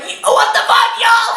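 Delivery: high, loud disbelieving shout; shocked yell. Retold or imitated. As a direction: blurt it out loud, totally shocked. Shocked reaction retold to friends.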